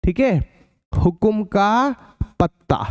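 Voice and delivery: slow, spaced out for writing down; slow dictation. Shown as a demonstration. Teacher dictating the fifth question, slow. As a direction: read the question out slowly, word by word. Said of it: "अब पांचवें question की बारी है".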